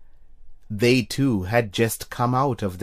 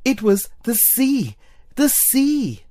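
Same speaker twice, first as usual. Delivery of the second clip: excited; 11 semitones higher than usual. A narrator's reading. Tina reaches the sea.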